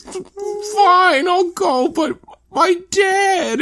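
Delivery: high falsetto, dragging out the end; hammed-up crybaby voice; mock whiny son. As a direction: give in with a tearful whine. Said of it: "He goes".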